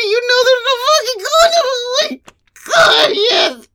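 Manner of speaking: high, urgent cries; breaking into moans. Acted. Wife insisting to her husband, breaking into moans. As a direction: insist in a high, moaning rush. Insisting while overcome by pleasure.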